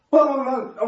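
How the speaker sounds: quick mock thumping sounds, bang bang bang; imitated banging noise. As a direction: knock it out like a machine pounding. (模仿机器砰砰声)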